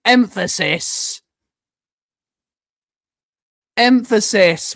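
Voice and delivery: each syllable of "Emphasis" stretched out; emphatic EMPHASIS. Shown as a demonstration. Streamer explaining the syllables to chat, emphatic. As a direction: say "Emphasis" slowly, leaning on it hard. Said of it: "It's called emphasis".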